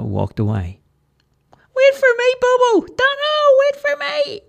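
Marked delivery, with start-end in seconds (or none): 1.5-4.4 very high, loud desperate squeal; loud high cartoon yell; squeaky scream. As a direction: shriek after them as they leave. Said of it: "Charlie screamed".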